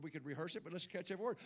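normal talking voice

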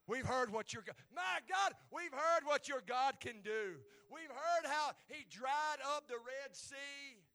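loud, high preacher's cry; full-force preaching. Acted. Preacher voicing Rahab's report of God's deeds, full-force preaching. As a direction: stretch the key words and drive them out loudly.

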